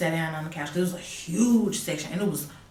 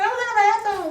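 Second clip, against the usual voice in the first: high mock innocence; 7 dB louder, faster than usual. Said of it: "She's like".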